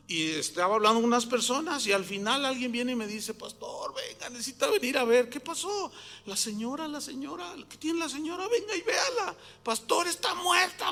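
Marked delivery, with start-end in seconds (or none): 3.3-10.9 hushed, high-pitched mock panic; imitated alarm. Messenger telling pastor the woman is dead, imitated alarm. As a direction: act out a frightened messenger fetching the pastor.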